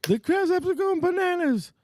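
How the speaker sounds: high excited commentator voice; Gorilla Monsoon impression. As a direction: call it like an old wrestling commentator. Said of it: "Gorilla Monsoon would have said".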